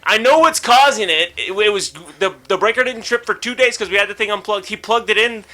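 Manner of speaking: worked-up venting about a know-it-all; mad. Retold or imitated. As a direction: argue the obvious, fed up. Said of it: "I was so mad".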